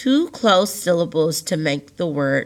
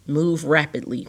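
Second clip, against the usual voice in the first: ordinary delivery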